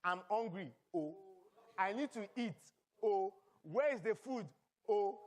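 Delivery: lightly put-on Nigerian accent, every line ending in O; hungry Nigerian accent, ending with O. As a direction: pastor imitating his hungry self to the congregation, in a light Nigerian accent. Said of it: "everything that I say starts, ends with an O".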